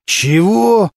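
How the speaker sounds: high, indignant one-word question; affronted, pitch jumping up. A narrator's reading. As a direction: bristle at the insult. Prosecutor outraged by the jibe.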